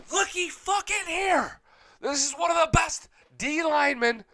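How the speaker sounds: hyped fan pointing something out; excited. Real, not acted. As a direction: pitch way up like you just spotted gold. Hyped rant reveals D-line draft.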